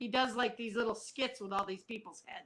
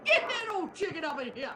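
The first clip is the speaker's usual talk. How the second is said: put-on loud, higher-pitched Cajun accent; 5 semitones higher, 6 dB louder than usual. Co-host trying out Cajun, hammed-up Cajun. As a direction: belt it out in joking Cajun. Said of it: "I need a Cajun".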